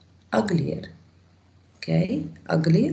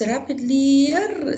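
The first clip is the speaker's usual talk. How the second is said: "rapidlier" drawn out with raised pitch; wrong-form demonstration; 7 semitones higher, 3 dB louder, much slower than usual. Shown as a demonstration. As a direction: stretch the ending of "rapidlier" to show it is clumsy. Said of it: "No, no, no".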